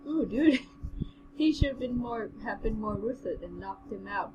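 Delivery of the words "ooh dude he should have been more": usual speaking voice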